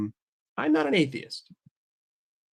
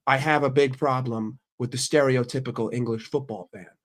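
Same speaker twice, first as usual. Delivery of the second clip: usual speaking voice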